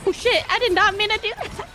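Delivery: high, loud yelp of shock; startled outburst. Real, not acted. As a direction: caught off guard, blurting it out. Sudden mistake in fight.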